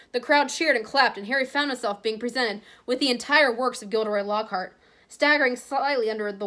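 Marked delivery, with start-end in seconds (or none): none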